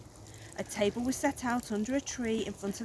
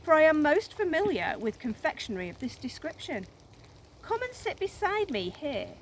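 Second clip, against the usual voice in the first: high, louder oily invitation; crocodile voice; 7 semitones higher, 4 dB louder than usual. A narrator's reading. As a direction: coax him in sweetly, slyly. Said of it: "said the crocodile".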